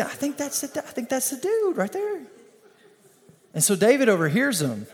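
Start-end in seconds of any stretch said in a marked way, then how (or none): 0.0-2.2 high-pitched whispery gossip voice. Retold or imitated. Philistines pointing out David, high mock gossip voice. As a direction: squeak it like a nosy onlooker.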